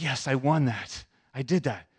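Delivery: drawn-out, cocky self-congratulation; confident. Retold or imitated. Preacher recalls gloating back in the kitchen, confident. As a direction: savor the win, slow and sure of yourself. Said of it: "I'm all confident".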